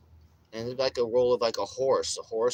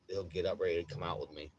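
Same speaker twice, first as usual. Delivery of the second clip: normal talking voice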